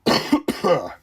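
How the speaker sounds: sudden loud cough; a real hacking cough. Real, not acted. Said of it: "Excuse me".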